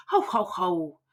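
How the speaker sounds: ugly giant laugh. A narrator's reading. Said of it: "The giant laughed an ugly laugh".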